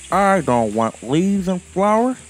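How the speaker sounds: high, bright little squirrel voice, wanting; a fussy squirrel turning up his nose; squirrel Teekensee. A narrator's reading.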